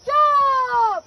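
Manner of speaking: loud excited yell; cheering shout. Real, not acted. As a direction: cheer him on at full voice. Cheering on a performer.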